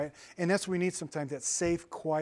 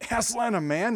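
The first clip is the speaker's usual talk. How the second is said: startled beaver; 6 semitones higher, 4 dB louder than usual. Acted. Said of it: "Mister Beaver sternly said".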